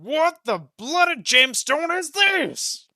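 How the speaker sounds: very loud, high, slow rage; furious yell. Acted. The boss yells at Roka over the chewed sapphire. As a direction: roar it out as you slam the gem.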